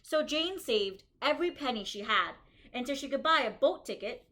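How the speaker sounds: bright, lively storybook reading for a class; reading to children. Acted. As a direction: read it out to the kids like a story. Reads picture book aloud.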